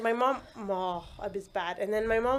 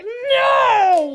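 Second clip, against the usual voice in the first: high, loud, hammed-up yell; retold labour-room shout; shouted refusal; 12 semitones higher, 12 dB louder than usual. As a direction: yell it in mock desperation. Retold refusal to give up gas.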